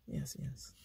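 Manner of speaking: a quiet, compliant yes, yes; soft quiet yes. Retold or imitated. To a hostile man, a quiet yielding yes, yes. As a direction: murmur agreement to end it. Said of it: "be Peter".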